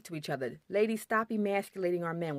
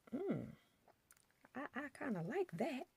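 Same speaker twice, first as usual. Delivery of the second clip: much softer, pleased and coy; soft swooning aside; 7 semitones higher, 12 dB quieter than usual. Retold or imitated. Wife swooning quietly at husband's firm tone. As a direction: melt a little, hushed and charmed.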